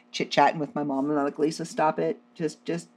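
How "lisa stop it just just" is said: hushed urging. Retold or imitated. Urging friend quietly beside phone.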